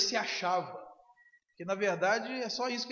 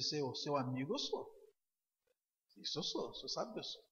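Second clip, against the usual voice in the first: softer, low, fading to almost nothing; humbled admission; 5 semitones lower, 8 dB quieter than usual. Acted. Peter admitting to Jesus, soft and humbled. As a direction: confess it quietly, head bowed.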